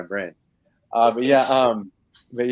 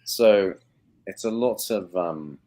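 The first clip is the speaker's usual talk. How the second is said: normal talking voice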